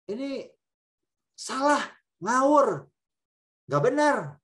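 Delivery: high, firm blunt verdict; thinking person correcting someone, blunt; blunt criticism. Acted. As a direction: tell them straight it is wrong.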